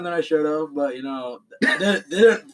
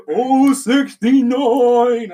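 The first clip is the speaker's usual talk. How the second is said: loud drawn-out exclamation, raised pitch; mock climax moan; 7 semitones higher, 7 dB louder, much slower than usual. Comic quoting a man at his climax, hammed-up moan. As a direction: stretch it out in hammed-up ecstasy.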